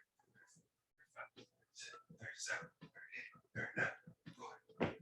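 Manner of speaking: breathless whispered counting under effort; strained whisper counting. Real, not acted.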